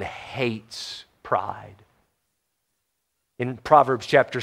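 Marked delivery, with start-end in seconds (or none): 0.0-1.8 slow breathy whisper of God hates pride; whispered emphasis. Real, not acted. Pastor to congregation, hushed whispered warning. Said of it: "I cannot emphasize that enough".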